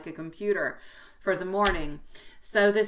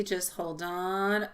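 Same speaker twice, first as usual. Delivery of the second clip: ordinary talk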